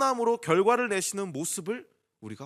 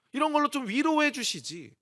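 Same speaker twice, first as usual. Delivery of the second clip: high, loud wish for comfort; aggrieved plea to God; hurt complaint; 6 semitones higher, 5 dB louder than usual. Acted. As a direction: act out feeling let down, voice raised. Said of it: "그런 서운한 감정이".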